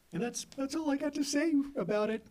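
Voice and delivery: high, choked-up wrap-up of a moving tale; mock tearful, pitched well up; moved speaker imitation. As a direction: imitate someone emotional finishing their story. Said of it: "really moving, gripping t-, tale".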